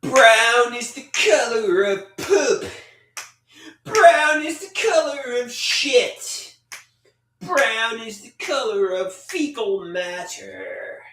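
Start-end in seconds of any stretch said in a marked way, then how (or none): 3.8-6.6 high, slow wailing cry on the whole line; mock wail. Chat joke, wailed reply.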